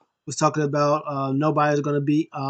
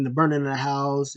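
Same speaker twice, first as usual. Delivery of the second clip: ordinary talk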